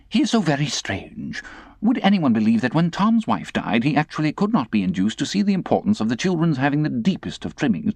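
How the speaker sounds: high, fussy lady's voice; affected lady. Acted. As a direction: complain like a fussy old aunt. Camilla's complaint.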